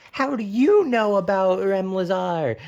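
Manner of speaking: put-on loud, high astonished teen; shocked teen boy. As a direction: blurt it out, startled. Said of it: "And he's like".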